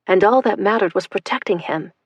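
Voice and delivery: plain reading voice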